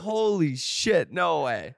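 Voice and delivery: slow, drawn-out amazed exclaim. Retold or imitated. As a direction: stretch it out in disbelief. Retelling seeing the glory holes.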